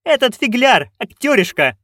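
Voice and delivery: high-pitched, contemptuous duchess; scornful snort. A narrator's reading. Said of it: "фыркнула герцогиня". (презрительно, на высоких тонах)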